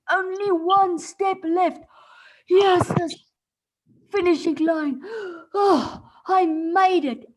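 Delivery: loud, slow triumphant cry; excited. Acted. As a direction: cheer reaching the finish line. Tortoise reaching finish.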